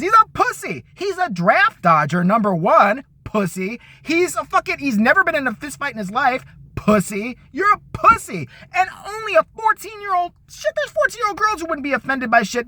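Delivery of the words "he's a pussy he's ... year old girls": angry yelled insults. Real, not acted. Comedian yelling insults at Trump.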